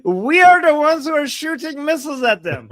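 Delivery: usual speaking voice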